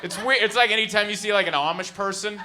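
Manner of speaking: ordinary delivery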